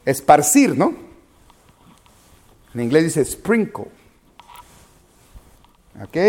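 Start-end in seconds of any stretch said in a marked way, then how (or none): none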